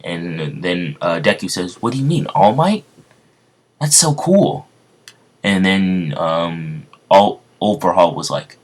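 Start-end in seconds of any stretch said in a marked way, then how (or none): 1.8-4.7 high, excited fan voice; thrilled boy squeal. Acted. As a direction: gush like a starstruck kid. Deku excited by All Might.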